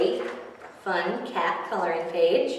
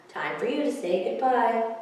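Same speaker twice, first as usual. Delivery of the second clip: plain reading voice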